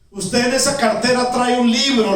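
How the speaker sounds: high, loud, eager voice; excited recognition. Acted. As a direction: declare it loudly, as if recognising a dream. Dreamer acts out the woman's recognition. (voz alta y fuerte, con entusiasmo)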